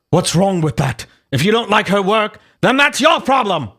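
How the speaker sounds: mock British defensiveness, rising in pitch; huffy retort in a put-on British accent. Host as Buckby defending her work, huffy British voice. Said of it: "read Buckby's voice in a British voice".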